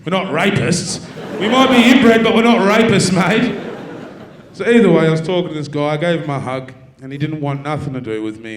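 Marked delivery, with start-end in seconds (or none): 0.0-3.6 playing the defensive local on the bridge; Tassie local. Comedian mocks the bridge guy's gruff reply, loud mock bloke voice. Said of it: "He goes".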